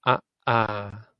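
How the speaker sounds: Ah short, ah held long; vowel pair short and long; short then long ah. Shown as a demonstration. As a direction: clip the first Ah, stretch the second ah. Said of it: "this one has to be said".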